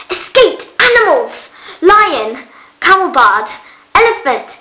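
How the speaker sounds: a delighted squeal of a list. Acted. Flavia reads Lupus's tablet aloud, squealing with delight. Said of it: "Flavia squealed".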